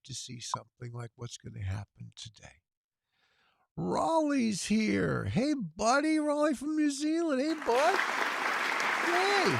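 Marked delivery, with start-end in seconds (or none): 3.8-9.5 much higher and louder, delighted hellos; excited greeting. Real, not acted. Host spotting his ham radio friend Raleigh, excited calling out. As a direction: call out to a buddy you're thrilled to see.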